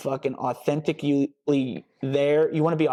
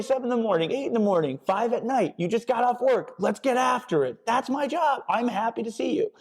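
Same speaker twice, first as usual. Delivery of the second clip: much higher, pumped and eager; hyped-up coach; 8 semitones higher than usual. Shown as a demonstration. Coach hyping a client at five in the morning, pumped. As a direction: fire up a sleepy client at dawn. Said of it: "Be enthusiastic".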